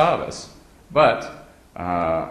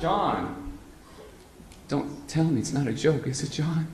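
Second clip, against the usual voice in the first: high, soft, hopeful begging; pleading; 5 semitones higher, 4 dB quieter, slower than usual. Retold or imitated. Begging John to say it isn't a joke, high and soft. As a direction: beg him to deny it.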